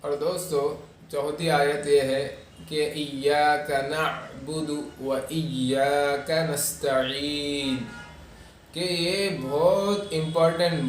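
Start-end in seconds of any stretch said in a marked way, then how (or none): 2.9-7.8 slow, elongated verse recitation; careful tajweed model; Quranic recitation. Shown as a demonstration. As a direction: recite measuredly, holding the final vowel long.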